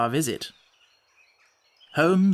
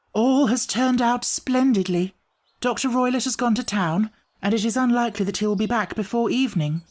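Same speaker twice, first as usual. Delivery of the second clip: put-on higher young woman's voice, relieved and hopeful; relieved young lady; 7 semitones higher than usual. Miss Stoner telling Holmes the coast is clear, relieved. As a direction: play the frightened client now glad.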